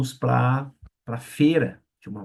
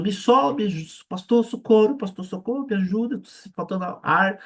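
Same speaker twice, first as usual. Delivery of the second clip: panicked cry for help; 8 semitones higher than usual. Retold or imitated. Fallen man on the floor begs the pastor, panicked. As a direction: scream for rescue, gasping. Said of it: "ele caiu no chão e começou".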